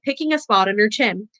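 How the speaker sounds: ordinary delivery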